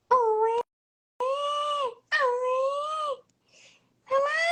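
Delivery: high loud wailing cry; mock baby cry. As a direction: wail like a baby. Baby crying noises again.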